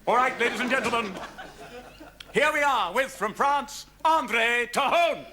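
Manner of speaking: announcer. Acted. Host introducing the French act to the audience, grandly. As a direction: announce the next act with fanfare.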